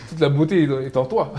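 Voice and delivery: said through loud laughter; laughing. Real, not acted. Host teasing the guest about his accent, laughing loudly.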